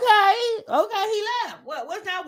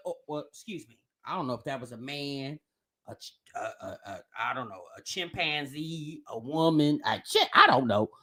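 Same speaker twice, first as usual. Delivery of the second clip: muttered aside; 8 dB quieter than usual. Real, not acted. Mock correction, muttered aside.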